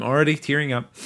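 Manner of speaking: a touch of real tears; tearing up with a sigh; choked up. Real, not acted. Co-host longing to return to Main Street, a little choked up. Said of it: "actually choked up about this".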